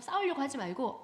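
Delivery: ordinary delivery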